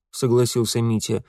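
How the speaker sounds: plain reading voice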